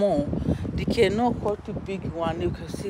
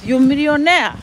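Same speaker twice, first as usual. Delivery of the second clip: excited shout; 7 semitones higher, 9 dB louder than usual. Real, not acted. Hyping the farm profits.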